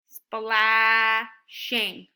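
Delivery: Splashing said slowly, each syllable opened wide; syllable demo. Shown as a demonstration. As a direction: stretch Splashing so the mouth opens twice. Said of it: "how many times our mouth opens".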